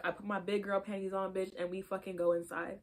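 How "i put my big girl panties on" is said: ordinary talk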